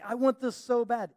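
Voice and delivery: a whiny demand; mock pleading whine. Believer begging God, mock whine. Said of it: "we're like".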